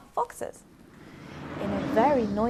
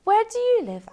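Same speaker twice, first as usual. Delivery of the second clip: fox asking; 13 semitones higher, 10 dB louder, much faster than usual. Acted. Fox asks owl.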